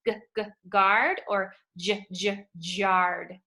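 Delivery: guard with a hard g, then jarred with a j sound; hard and soft g contrast. Shown as a demonstration. Teacher testing guard against jarred for the class. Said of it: "hard G or a soft G".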